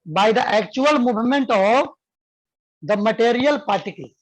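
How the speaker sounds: slow, clear dictation for students to write. Shown as a demonstration. Teacher dictating a definition to students, slow and clear. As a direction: spell it out slowly so they can copy. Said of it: "लिखो बाबू".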